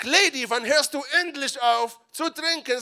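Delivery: high, loud scolding question; scolding priest. Acted. Priest Eli rebuking Hannah for drinking, high and loud.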